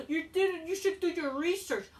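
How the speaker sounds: mocking fan excuse. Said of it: "you're gonna come with excuse".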